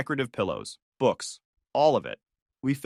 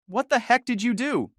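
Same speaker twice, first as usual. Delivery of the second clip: high-pitched outraged cry, not full volume; mock scream; 11 semitones higher than usual. A narrator's reading. Wife confronting husband by phone, mildly screamed. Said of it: "she screamed".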